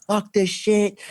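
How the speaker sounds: high-pitched mock cursing; imitating your own panicked swearing; retold cussing. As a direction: cuss in a worked-up high voice. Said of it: "they heard me cussing".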